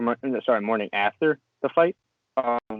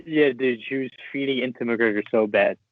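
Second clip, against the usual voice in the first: usual speaking voice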